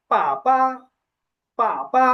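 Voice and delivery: first পা falls deeply, second পা is toneless; falling then neutral tone. Shown as a demonstration. Teacher modelling for repetition, falling then neutral tone on পা, পা. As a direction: model পা, পা as fourth tone plus neutral. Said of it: "এখানে হচ্ছে falling tone আছে বা four tone".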